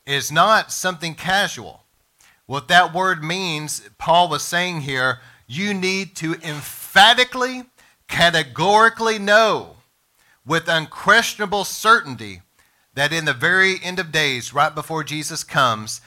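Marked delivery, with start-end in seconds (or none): 5.5-9.8 EMPHATICALLY and CATEGORICALLY punched high and loud; emphatic stress. Real, not acted. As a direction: drive home "emphatically, categorically know", raised and forceful. Preacher stresses his expanded reading of verse.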